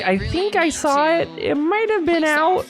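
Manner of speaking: high-pitched, tentative player voice; high mock-uncertain quote; Jeannie imitation. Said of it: "Jeannie's like".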